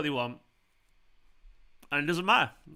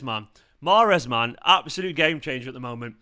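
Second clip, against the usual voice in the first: ordinary delivery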